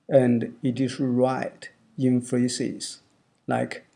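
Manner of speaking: ordinary talk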